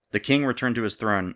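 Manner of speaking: ordinary delivery, nothing marked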